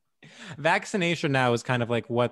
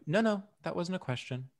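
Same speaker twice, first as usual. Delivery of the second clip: lightly imitated screen interrogator, higher and softer; a hint of a movie inquisitor, pitched up; Meryl Streep; 4 semitones higher, 6 dB quieter than usual. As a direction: quietly shut down the reply, film-quote style. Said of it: "It's Meryl Streep going".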